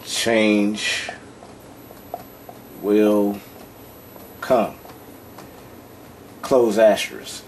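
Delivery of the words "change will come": each word alone, long pauses between; slow dictation. Real, not acted. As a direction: dictate slowly, one word at a time. Writing the example out as spoken.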